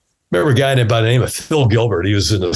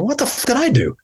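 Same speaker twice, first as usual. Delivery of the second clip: pissed off. Retold or imitated. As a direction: retell your annoyed bafflement with pitch shooting up. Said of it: "I'm kinda pissed going".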